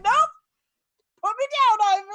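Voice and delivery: high, loud indignant protest; Shiloh protesting. Acted. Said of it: "so she's just like".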